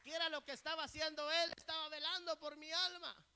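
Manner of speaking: rally the congregation; full-force preaching. Real, not acted. Rallies congregation to applaud. (predicación exaltada a toda voz)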